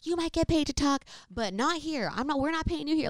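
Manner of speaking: high-pitched mock anger; imitated angry brother. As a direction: mimic an irked brother snapping back. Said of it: "he's getting all angry".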